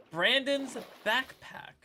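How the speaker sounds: slow, careful retry after a tongue slip; deliberately slow. Real, not acted. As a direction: say "Brandon's backpack" slowly, word by word. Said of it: "I had to say it slowly".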